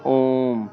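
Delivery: ओम with a long-held vowel; प्लुत स्वर. Shown as a demonstration. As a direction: stretch ओम out over three beats. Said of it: "तीन मात्रा का समय लगता है". (ओम को लंबा खींचकर बोलें)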